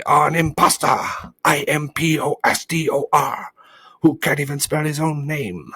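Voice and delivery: high, loud denunciation; excited shout. A narrator's reading. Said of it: "shouted the bee very excitedly".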